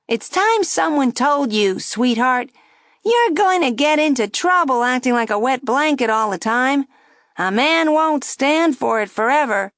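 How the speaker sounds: high, loud wisecracking puppet; a brash ventriloquist dummy needling; cheeky dummy voice. A narrator's reading. Dummy mocking the girl at the table, high and brash. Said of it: "the dummy said".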